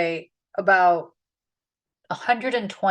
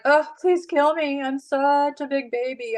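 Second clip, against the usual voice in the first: higher, mock babyish whine; whiny Jonah; 5 semitones higher than usual. Jonah begging to die under the scorching wind, mock whiny. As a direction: play up a sulking prophet. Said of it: "and Jonah's like".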